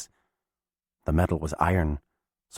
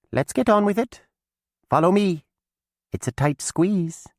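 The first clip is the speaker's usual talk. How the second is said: high, loud brisk witch; Mab, brisk; 12 semitones higher, 4 dB louder than usual. A narrator's reading. Mab moves on, high and brisk. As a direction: get down to business.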